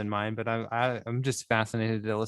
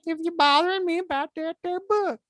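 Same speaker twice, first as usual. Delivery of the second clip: much higher and louder nagging mom voice; shrill mock mother; 7 dB louder than usual. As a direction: snap at the kid like a trashy mom. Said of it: "as high pitched as you did".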